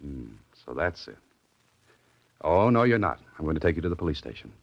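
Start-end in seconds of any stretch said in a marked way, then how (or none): 2.4-3.2 raised, higher, firm no; firm refusal. Acted. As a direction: put your foot down. Dan firmly refuses the girl.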